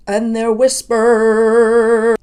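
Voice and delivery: slow, loud mock of his closed vowels on "whisper"; imitating his vowels. Showing the singer's vowel choice, slow and loud. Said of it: "Some of his vowels that he's choosing".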